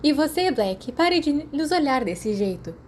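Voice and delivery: put-on high, teasing girl's voice. A narrator's reading. Lily teases Sirius for staring, high and playful.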